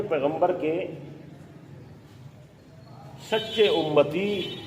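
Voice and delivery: slow, measured dictation, phrase by phrase; teacher dictating notes to students, slow and deliberate. Acted. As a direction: dictate each phrase so the class can write.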